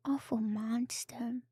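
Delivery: normal talking voice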